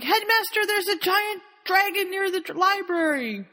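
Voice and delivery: high-pitched frantic announcement; acted alarm. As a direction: burst in with panicked news, voice way up. Sir Nicholas raises the alarm.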